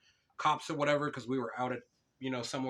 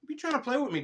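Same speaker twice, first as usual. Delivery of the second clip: mock outrage; 12 semitones higher, 4 dB louder than usual. Real, not acted.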